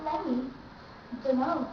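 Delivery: "Let me, don't know" as lemme, dunno; no T in either "Let me" or "don't know"; T dropped. Shown as a demonstration. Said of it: "Once more".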